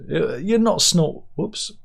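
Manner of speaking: usual speaking voice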